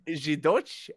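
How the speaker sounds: normal talking voice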